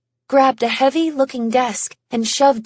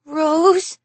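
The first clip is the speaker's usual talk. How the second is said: put-on shaking child voice; 5 semitones higher than usual. A narrator's reading. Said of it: "his little voice shaking now".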